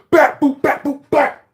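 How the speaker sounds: very high, loud slap sounds; mock beating noises. Imitating his mom whooping him. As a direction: hammer out the blows.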